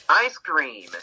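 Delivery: "Ice cream" in two English syllables; English pronunciation. Shown as a demonstration. Teacher giving the English before the Korean, "Ice cream" in two syllables. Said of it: "It's two syllables in English".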